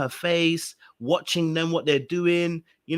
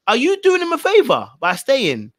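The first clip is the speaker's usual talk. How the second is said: loud, high disbelief at a TV contestant; yelling at the screen that she's lost her mind; incredulous rant; 6 semitones higher, 6 dB louder than usual. Real, not acted. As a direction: shout it in disbelief. Ranting at Ella on TV.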